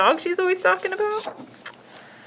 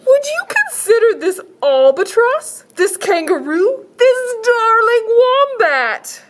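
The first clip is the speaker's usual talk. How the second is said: high, slow salesman pitch; shelter man voice; 12 semitones higher, 7 dB louder than usual. Acted. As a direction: sell it like an eager shopkeeper.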